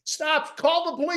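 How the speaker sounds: very high, strained cry to call the police; shrill fake panic, retold; mock scream for help. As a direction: ham up the bully playing victim. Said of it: "he's the one screaming".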